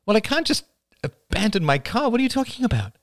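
high-pitched, incredulous objection; exasperated protest. Acted. Rachel, stuck in traffic, protests to Oliver, exasperated. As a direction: protest with the voice jumping up. Acted phone argument, exasperated driver.

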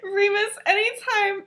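high voice, cracking up; telling through laughter. Real, not acted. Telling a friend the best part, laughing helplessly. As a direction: struggle to get it out while laughing.

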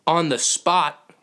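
on the spot said slow, high and loud; stressed title. Real, not acted. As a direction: land on the spot slowly, every word hit. Punching the show's title.

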